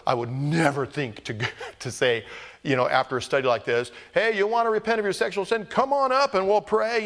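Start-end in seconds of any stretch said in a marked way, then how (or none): 4.1-7.1 higher-pitched, quick hearty invitation; mock altar call. Preacher inviting repenters up to pray after a study, mock altar call. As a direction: call them up front like an over-eager preacher.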